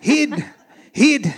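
loud, high Scottish yell; comic Scottish movie shout; Scottish accent. Acted. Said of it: "learned how to speak a little Scottish".

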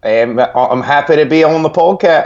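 put-on a weak try at an Australian accent; attempted Australian accent. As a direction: try an Aussie greeting and give up on it. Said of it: "I can't do the Australian accent".

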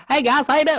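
high, hammed-up southern accent; southern twang. Imitating a relative back from college, mock southern twang. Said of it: "she had a little southern twang".

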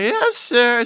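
high-pitched, obliging reply; acted-out eager yes to a big tipper; eager compliance. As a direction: say it high, like a dutiful employee. Deferring to generous customer.

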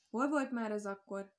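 plain reading voice

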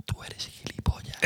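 slow, voiceless whisper; imitated slow, soundless insult; mouthed whisper. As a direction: mouth it silently so it can be lip-read. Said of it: "se le lee perfectamente los labios".

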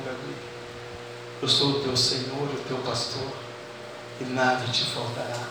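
soft low voice of God, close; right in your ear. Pretend. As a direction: murmur it gently into the ear. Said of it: "Bem suave. aquela voz gostosa".

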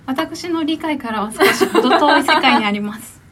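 put-on lightly polite rich young lady; haughty rich girl Japanese. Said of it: "もうちょっとお嬢様".

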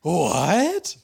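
high, loud, rising cry of disbelief; mock shock. Ari hears he killed thirteen people, hammed-up shock. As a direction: play floored, pitch and volume shooting up. Acted Ari learns forgotten past.

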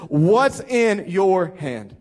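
"What's in your hand?" very slow, each word landed separately; emphatic key question. Acted. Preacher drives home his key question.

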